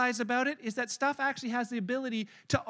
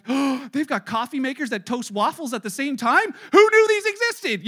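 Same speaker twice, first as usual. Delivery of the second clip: wide-eyed wonder at a gadget; amazed shopper; 2 semitones higher, 9 dB louder than usual. Acted.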